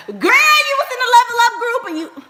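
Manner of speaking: high, loud laughing mock gossip. At the wedding, a loud laughing tactless guest. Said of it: "I ain't gonna be like".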